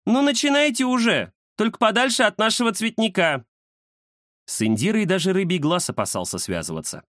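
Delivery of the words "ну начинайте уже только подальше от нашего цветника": high, bossy and impatient; impatient cat lady. Acted. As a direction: hurry them along irritably. Indira impatient.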